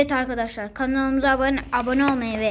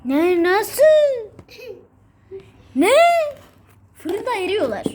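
surprised; 9 semitones higher, 6 dB louder, slower than usual. Real, not acted. Things melting in oven.

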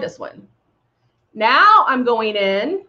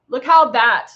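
ordinary talk

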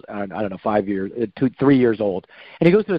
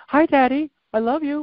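put-on high, sweet little-girl voice; mock sugary teenage daughter; imitated daughter; 14 semitones higher than usual. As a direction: pitch it way up, all innocence. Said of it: "She's like".

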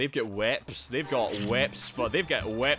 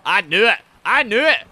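loud, raised-pitch yelling; loud outraged cry; vindicated shouting; 8 semitones higher, 12 dB louder than usual. Real, not acted. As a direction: shout it twice as the trap springs. Yells as trap springs.

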